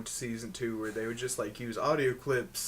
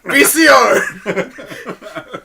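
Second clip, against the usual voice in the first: laughing shout; 10 semitones higher, 18 dB louder, much slower than usual. Retold or imitated. Quoting clip, laughing through.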